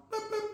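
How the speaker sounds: high soft boop boop; a little high dismissal beep; boop sound effect. Acted. Players cut from the roster, soft high boop.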